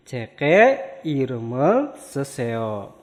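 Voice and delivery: ceke iremel seseo said slowly and joined; slow linked reading. Shown as a demonstration.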